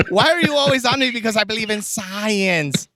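high excited movie quote; Nacho Libre quote. Retold or imitated. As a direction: act out the movie scene. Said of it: "Esqueleto and goes".

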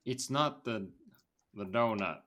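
Southern accent attempt. Shown as a demonstration. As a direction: fumble your way through a Southern accent. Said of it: "try and do the accent".

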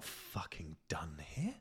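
slow conspiratorial whisper; hushed, sneaky whisper. Retold or imitated. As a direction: whisper it like a secret plan. Conspiratorial inner voice.

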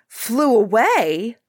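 the king catches the words in shock; startled king repeating the seer. Acted. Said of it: "cried the king, startled".

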